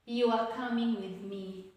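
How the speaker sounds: a plain statement settling down on "me"; falling intonation. Shown as a demonstration. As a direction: let "me" drop like a full stop. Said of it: "will take the falling intonation".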